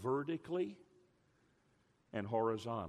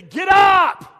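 loud, high shouted order; coach's shout; 25 dB louder than usual. Retold or imitated. Coach shouting at a knocked-down player to get up. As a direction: roar it down at the boy on the ground. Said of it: "he'd say".

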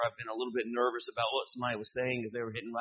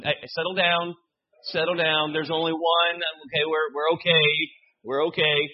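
loud, raised call to settle everyone; mock calming; 4 semitones higher, 9 dB louder than usual. Preacher telling congregation there's only one version, loud mock reassurance. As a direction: call over the room, much louder.

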